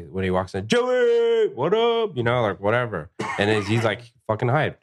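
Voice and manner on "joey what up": high, drawn-out call of a name; whooping, high-pitched welcome; yelled greeting. Retold or imitated. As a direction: hail a regular as he walks in. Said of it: "I'll yell your fucking name".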